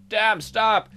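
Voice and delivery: normal talking voice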